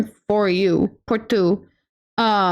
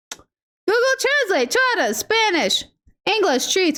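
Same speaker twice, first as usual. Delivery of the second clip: mock robotic readout; 6 semitones higher than usual. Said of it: "it was like".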